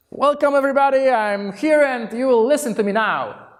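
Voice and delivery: domineering welcome. Shown as a demonstration. Coach mocks a pushy opening at demo day, commanding. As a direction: announce yourself like a boss.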